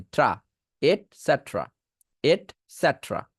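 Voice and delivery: এটসেটেরা ending in tra, et-set-ra; et cetra variant. Shown as a demonstration. Teacher offering the shorter এটসেটেরা variant. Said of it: "ট্রাও বলতে পারবেন".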